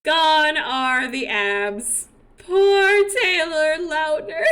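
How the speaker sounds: slow, loud, sung mourning; mock lament. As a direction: wail over the lost abs, hammed-up. Mourning lost abs.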